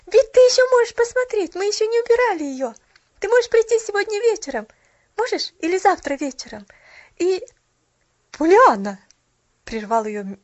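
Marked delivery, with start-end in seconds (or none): 0.1-7.4 high, loud excited chatter; eager girl. A narrator's reading. Girl inviting shopgirl, eager and excited. Pollyanna's voice in story. 8.3-9.0 loud, cold sharp call; icy tone. A narrator's reading. Mrs Carew cutting off the girl, icy. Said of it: "ледяным тоном".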